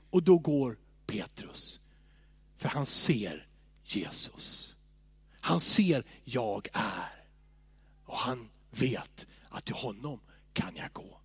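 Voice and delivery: soft, slow, quiet preaching voice; hushed devotional. Acted. Preacher speaking softly to the congregation. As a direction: draw them in with a hush.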